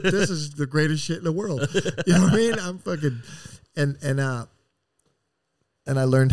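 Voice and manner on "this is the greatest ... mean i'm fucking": laughing while telling. Real, not acted. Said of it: "laughs while telling".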